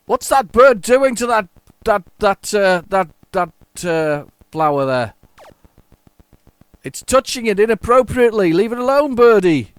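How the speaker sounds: high, loud telling-off of a bird; mock outrage. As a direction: scold the birdie in playful high-pitched indignation.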